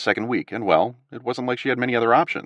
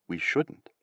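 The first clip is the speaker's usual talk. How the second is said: soft echo of "we shouldn't", much quieter; conspiratorial; 5 semitones lower, 9 dB quieter than usual. A narrator's reading. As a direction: repeat it as a shared secret. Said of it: "the more conspiratorial".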